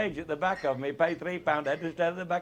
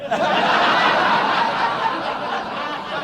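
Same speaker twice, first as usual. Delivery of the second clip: helpless high wheezing laugh; can't hold back the laughter, airy and high; big wheezing laugh; 11 semitones higher, 9 dB louder than usual. Real, not acted. Laughing at his own story's punchline, big wheezing laugh.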